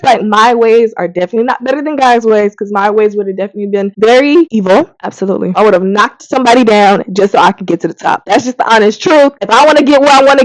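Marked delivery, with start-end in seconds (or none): none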